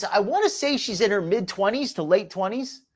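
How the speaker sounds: ordinary talk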